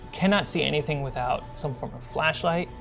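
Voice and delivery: normal talking voice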